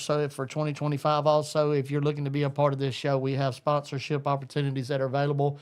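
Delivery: ordinary delivery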